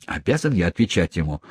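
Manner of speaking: ordinary delivery, nothing marked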